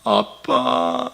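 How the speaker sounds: put-on high, very slow, tearful child voice; a small child's drawn-out plea to daddy; child calling daddy. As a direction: call daddy like a crying little child. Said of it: "아빠의 마음을 아는 자식은 어떡합니까?".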